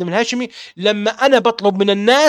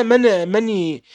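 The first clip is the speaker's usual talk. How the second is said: usual speaking voice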